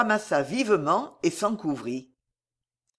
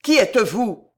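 louder, higher, unhinged question; crazed voice; 4 semitones higher, 7 dB louder than usual. A narrator's reading. Farnèse demanding to know the stranger, crazed. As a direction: ask as if losing your mind. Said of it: "demanda Farnèse d'une voix démente".

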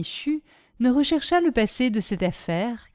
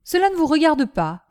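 higher-pitched, firm retort; curt rebuff; 7 semitones higher, 4 dB louder than usual. A narrator's reading. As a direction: shut the question down. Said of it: "répondit Michu".

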